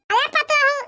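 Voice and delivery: loud call to the daughters-in-law. Acted. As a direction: call out across the house to stop the quarrel. Mother-in-law calls the daughters-in-law.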